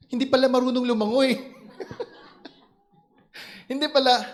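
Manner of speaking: loud laughing punchline. Real, not acted. Pastor laughing at uncle who cannot swim, loud and laughing. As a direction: laugh through the reveal.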